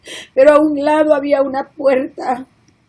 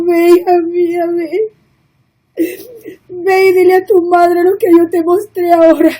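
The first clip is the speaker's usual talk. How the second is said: crying; 3 semitones higher, 3 dB louder than usual. Real, not acted. Tearfully telling the Lord's words.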